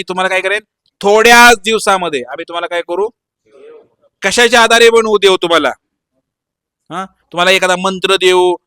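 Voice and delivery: loud, projected pitch with a hint of salesman; lightly acted huckster, loud throughout; mock guru sales pitch. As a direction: imitate a guru advertising his courses. Acting fake gurus' office pitch.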